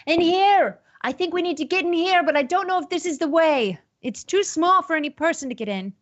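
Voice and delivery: high-pitched, hurried call to companions. Acted. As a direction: yell down to the party in a high voice. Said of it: "he yells to you".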